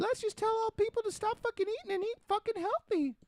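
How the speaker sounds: very high-pitched mock voice; mocking naive do-gooder. Mimicking people who only talk about obesity, mocking. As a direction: squeak it out as a clueless idealist.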